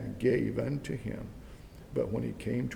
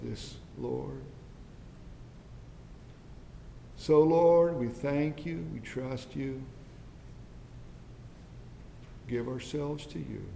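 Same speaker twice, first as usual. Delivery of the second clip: soft, earnest prayer voice; closing prayer; 2 semitones lower, 4 dB quieter than usual. Acted. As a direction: pray humbly for the congregation.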